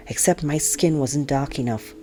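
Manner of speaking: usual speaking voice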